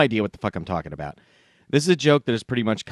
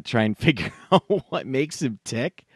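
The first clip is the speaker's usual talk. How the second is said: ordinary delivery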